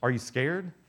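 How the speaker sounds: usual speaking voice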